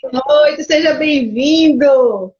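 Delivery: big, slow, loud welcome. Real, not acted. Host welcoming the invited guest onto the live, loud and slow. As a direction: announce the guest with a booming hello.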